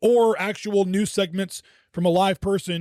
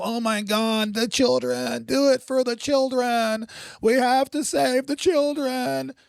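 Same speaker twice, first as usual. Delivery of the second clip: a touch of mock pleading, slightly higher; mocking politician; 4 semitones higher than usual. Mocking politicians who use children, lightly hammed plea. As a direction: parody an overwrought campaign plea. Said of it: "all emotional?".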